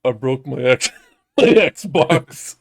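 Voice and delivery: flat, deadpan confession; monotone voice. Retold or imitated. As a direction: say it with no feeling at all. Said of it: "like, the most monotone voice".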